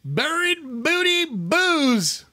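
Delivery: high, slow, hammed-up teaser voice; silly high voice. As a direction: stretch it out in a silly squeal.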